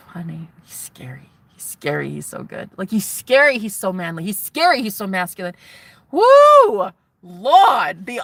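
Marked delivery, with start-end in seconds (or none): 0.1-2.7 breathless whispered awe. Real, not acted. To viewers about her new man, awed whisper. 6.1-6.9 high loud swooning woo; excited whoop. Real, not acted. Swooning over the man's voice, loud high whoop.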